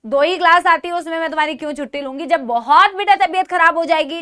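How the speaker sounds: usual speaking voice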